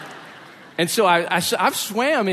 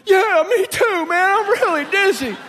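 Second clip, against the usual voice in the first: much higher, slow and loud; called out, dizzy; 6 dB louder than usual. Retold or imitated. Dizzy friend calls across the water, much higher and slow. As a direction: call it out, woozy and drawn out.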